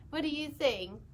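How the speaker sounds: ordinary delivery, nothing marked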